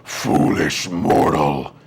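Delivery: low, slow, billowing roar; dragon voice. Acted. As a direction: boom it out, huge and slow. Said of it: "a billowing voice".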